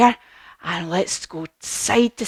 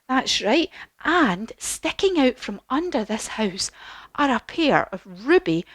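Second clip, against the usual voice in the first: ordinary delivery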